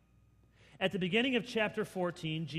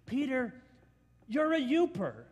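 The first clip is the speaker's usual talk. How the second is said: loud, high mocking taunt; teasing bystanders; 8 semitones higher, 7 dB louder than usual. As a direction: needle him about his accent. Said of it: "the bystanders saying".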